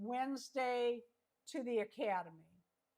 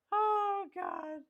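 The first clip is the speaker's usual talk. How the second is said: high laughing oh God; amused surprise; 9 semitones higher, 6 dB louder than usual. Real, not acted. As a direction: burst out in amused disbelief. Laughs at Ophelia Frump name.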